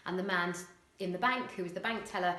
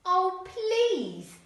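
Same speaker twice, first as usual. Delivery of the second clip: much higher, louder sing-song falsetto; mock the boy in a falsetto; 11 semitones higher, 8 dB louder than usual. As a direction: Eric mocking the boy's manners in falsetto. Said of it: "Eric sang the word in a falsetto voice".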